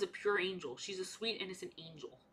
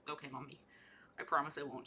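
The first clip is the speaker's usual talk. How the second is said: low, hushed obedient reply; meek retold promise; 7 semitones lower, 11 dB quieter, faster than usual. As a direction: imitate a chastened child, very softly. Retold child promising scolding mother.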